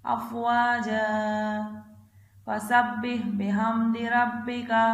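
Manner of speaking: slow, drawn-out tajweed chant, phrase by phrase; Quran recitation. Acted. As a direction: chant each phrase slowly for the child to repeat. Third recitation of the surah.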